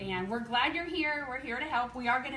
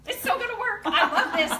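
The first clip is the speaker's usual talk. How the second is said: much higher, loud thrilled squeal with a laugh; 10 semitones higher, 8 dB louder than usual. Retold or imitated. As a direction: burst out in giddy joy. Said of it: "I'm like".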